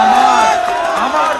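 sudden loud call to the crowd; a rally shout. Real, not acted. (চিৎকার করে ডাক)

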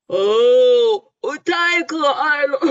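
loud laughing teasing call; 野狗大喊. A narrator's reading. 澳洲野狗大声嘲笑. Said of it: "一只澳洲野狗大喊道".

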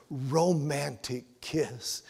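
ordinary delivery

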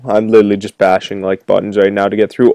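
usual speaking voice

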